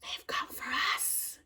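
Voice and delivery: slow dramatic stage whisper; hammed-up spooky whisper; mock trailer answer. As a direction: whisper it for a laugh, drawn out. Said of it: "says out loud so everyone can hear".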